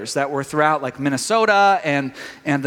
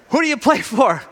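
coach shouting; 5 semitones higher than usual. Retold or imitated. The coach shouts at his exhausted players, hammed up. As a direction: yell it down the line like an angry coach.

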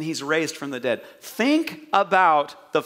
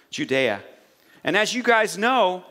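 normal talking voice

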